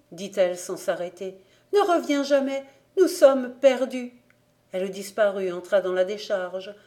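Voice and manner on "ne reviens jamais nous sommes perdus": desperate warning. A narrator's reading. Desperate warning to Jean-Némi.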